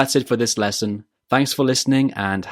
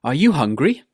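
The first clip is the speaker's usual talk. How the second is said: "Are you hungry?" going up at the end; rising intonation; 4 semitones higher, 3 dB louder than usual. Shown as a demonstration. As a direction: lift the pitch on "hungry?". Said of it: "needs to go up at the end".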